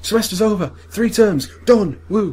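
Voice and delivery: loud, high celebration; elated end-of-term cheering; triumphant shout. Acted. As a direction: shout each phrase like a victory. Acting end of semester celebration.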